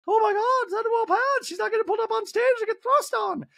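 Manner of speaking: very high falsetto put-on voice; Dorit. Dorit passing Kyle an update, squeaky mock stage whisper. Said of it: "Dorit then turns to Kyle and whispers".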